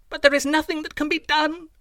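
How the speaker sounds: put-on a young man's high, despairing cry; cried out in despair. A narrator's reading. Young man pleads his lost youth, high despairing cry. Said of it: "I cried".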